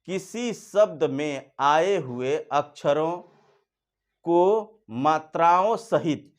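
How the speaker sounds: slow, clearly spaced dictation; slow, deliberate Hindi definition read. Shown as a demonstration. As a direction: spell each word out slowly for the class. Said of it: "फिर सुनिए".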